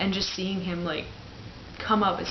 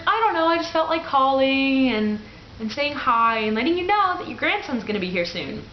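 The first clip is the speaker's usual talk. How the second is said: higher, louder, sing-song excuse; giddy sheepish reply; 8 semitones higher, 6 dB louder than usual. Retold or imitated. Daughter explaining to her dad why she called, higher and giddy. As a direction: bubble it out innocently.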